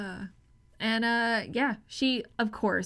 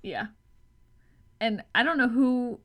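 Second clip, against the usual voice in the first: usual speaking voice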